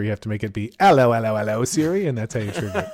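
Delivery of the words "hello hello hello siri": British greeting. Retold or imitated. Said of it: "if it's, like, British here".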